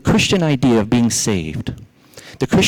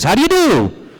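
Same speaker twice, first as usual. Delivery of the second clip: high, punchy bossy greeting; bossy rabbit's high-pitched hello; 11 semitones higher than usual. Acted. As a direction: greet them like you run the place, pitched way up. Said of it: "he's the boss".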